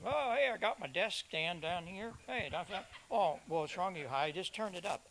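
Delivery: lightly voiced clueless ham, hushed; imitating a ham. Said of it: "I hear guys all the time".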